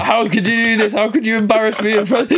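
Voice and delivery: high-pitched hammed-up scolding; mock telling-off, pitched well up; mock screaming. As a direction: play an outraged fake dad. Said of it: "bare, like, screaming at me".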